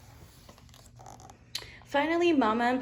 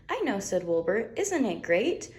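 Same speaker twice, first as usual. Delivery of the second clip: delighted little lamb; 3 semitones higher than usual. Acted. Wilbur's catchphrase.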